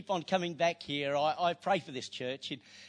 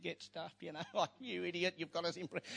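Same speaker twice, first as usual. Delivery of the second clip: grumbling; 10 dB quieter than usual. Retold or imitated. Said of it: "And Silas would have said".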